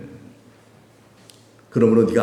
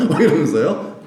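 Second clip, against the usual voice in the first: ordinary talk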